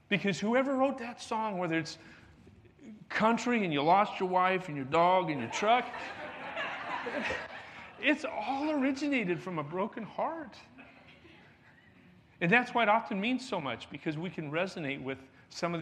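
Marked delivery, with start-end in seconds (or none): 3.1-10.6 laughing mid-joke. Real, not acted. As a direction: chuckle through the punchline. Joking about country songs.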